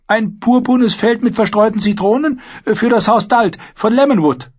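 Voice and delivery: high, loud squire's voice; eager boy answering his lord; hopeful young squire. Acted. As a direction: recite it eagerly, pitched well up.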